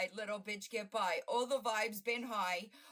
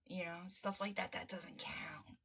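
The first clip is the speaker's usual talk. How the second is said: whisper; 4 semitones lower, 5 dB quieter than usual. Real, not acted. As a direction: drop to a whisper. Whispered aside.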